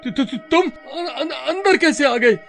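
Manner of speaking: quick, stuttering shock; startled stammer. Acted. Raju finds the ghost inside, stammering in fright. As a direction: stammer at a sudden intruder.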